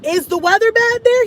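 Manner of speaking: high, worried question; anxious question. Retold or imitated. Asking Cash's dad on the phone, worried. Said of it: "I'm like".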